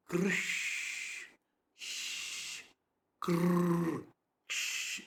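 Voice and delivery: "Krish" broken up, long rolled "kr" and hissed "sh"; isolated sounds. Shown as a demonstration. Teacher isolating sounds for students, each one held long. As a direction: hold a trilled "kr", then a long voiceless "sh". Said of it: "the way of pronunciation is very important".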